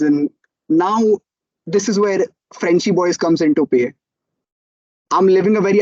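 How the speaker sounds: ordinary talk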